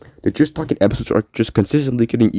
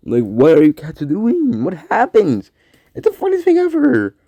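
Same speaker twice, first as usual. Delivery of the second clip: high, slow, amused squeal at the cats; tickled cat owner; amused disbelief; 9 semitones higher than usual. Real, not acted. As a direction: pitch it way up, half laughing. Amused at cats' random jumps.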